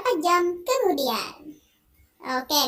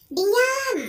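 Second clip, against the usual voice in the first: much higher, loud screamed silence; raging shout; 9 semitones higher, 6 dB louder than usual. Acted. Husband screaming at his crying wife to shut up, raging high shout.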